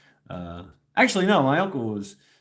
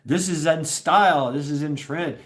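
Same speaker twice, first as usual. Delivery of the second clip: higher-pitched mock parental approval; enthusiastic parents; 6 semitones higher than usual. Parents pushing corduroys, high mock enthusiasm. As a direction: sell it like an upbeat parent. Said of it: "my parents always like".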